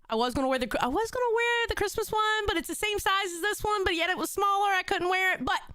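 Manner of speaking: ordinary delivery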